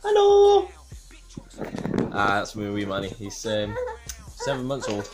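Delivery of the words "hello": put-on squeaky high loud baby hello. Voicing baby's hello.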